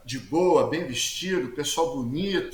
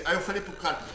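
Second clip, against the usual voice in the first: usual speaking voice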